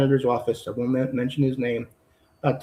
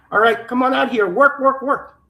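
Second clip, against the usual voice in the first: higher, louder mock trafficker command; barked orders; 9 semitones higher, 4 dB louder than usual. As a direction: yell it like the man who rousted her at night. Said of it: "pounding on the table".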